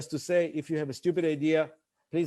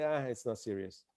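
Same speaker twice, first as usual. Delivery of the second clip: dropped soft, throwaway; dismissive aside; 4 semitones lower, 10 dB quieter than usual. Retold or imitated. Presenter losing the room, quiet and throwaway. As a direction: mumble it off as if it doesn't matter. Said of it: "When you say".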